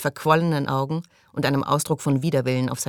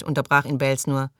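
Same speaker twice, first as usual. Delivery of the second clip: ordinary delivery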